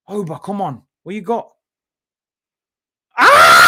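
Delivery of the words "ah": short loud startled yell; a burst of a yell at the play; sudden loud shout. Real, not acted. Sudden goal-mouth reaction.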